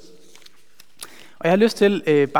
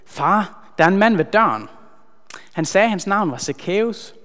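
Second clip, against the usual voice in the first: calling out; 4 semitones higher, 4 dB louder than usual. Acted. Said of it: "hun starter med at råbe".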